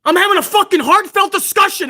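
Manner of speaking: normal talking voice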